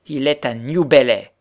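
"New Bellay" said loudly and distinctly; distinct articulation. A narrator's reading. Widow watching the effect on the girl, "New Bellay" articulated loudly. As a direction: punch out "New Bellay" word by word. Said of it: "articula distinctement la veuve".

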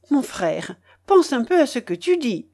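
sharp, insistent protest; a touch of vehemence; vehement. A narrator's reading. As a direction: push back hard at him. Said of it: "observa avec véhémence".

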